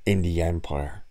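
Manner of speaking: normal talking voice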